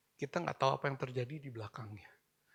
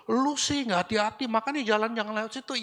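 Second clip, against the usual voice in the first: high, loud finger-wagging lecture; mock scolding; 8 semitones higher, 7 dB louder than usual. Preacher acting out a bystander scolding a fallen man, high mock rebuke. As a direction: tell him off for not looking. Blaming someone who just fell.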